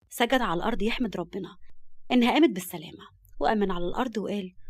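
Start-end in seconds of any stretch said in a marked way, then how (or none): none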